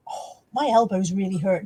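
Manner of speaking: normal talking voice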